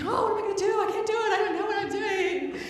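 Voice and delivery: mock panicked worry; a touch of frantic; freaking out. Retold freaking out.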